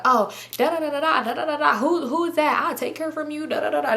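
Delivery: high, sing-song nonsense mimicry of an argument; mock bickering. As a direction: parody her going on and on. Mimicking their back-and-forth argument.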